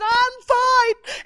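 high, loud tearful squeak; imitating a choked-up, high-pitched insistence; mock sobbing. Preacher retelling her answer while sobbing, mock tearful high voice. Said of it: "like, I was sobbing".

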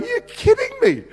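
high squeaky incredulous yelp; disbelief. Retold or imitated. Son's stunned reaction to mum.

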